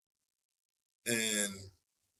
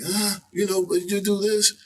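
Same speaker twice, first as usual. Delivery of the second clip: higher, wheedling imitation; imitated coaxing; 9 semitones higher, 4 dB louder than usual. Label people talking the reluctant artist into it, imitated coaxing. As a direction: coax in a put-on higher voice.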